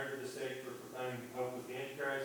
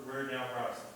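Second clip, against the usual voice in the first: ordinary talk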